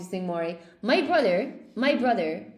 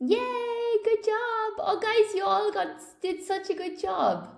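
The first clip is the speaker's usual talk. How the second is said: much higher, bright bursts of praise; excited praise; 11 semitones higher than usual. Real, not acted. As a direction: cheer the whole class on. Praising the class's quiz results.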